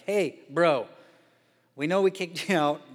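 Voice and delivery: butter him up, buddy-buddy; mock friendly brothers asking him back; brothers sucking up. Brothers voiced asking favour.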